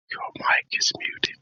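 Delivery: breathy stage whisper. Real, not acted. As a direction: whisper it across the room. Signalling muted guest silently.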